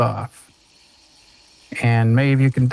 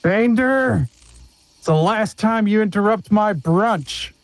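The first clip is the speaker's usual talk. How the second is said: angry shout; 6 semitones higher than usual. Acted. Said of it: "a loud voice ... shout out".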